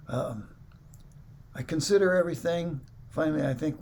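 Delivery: normal talking voice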